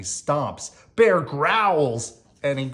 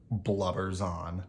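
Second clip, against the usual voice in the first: sleepy hush; 7 semitones lower, 8 dB quieter than usual. A narrator's reading. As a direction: lower and much softer, drifting off. Bear crying refrain.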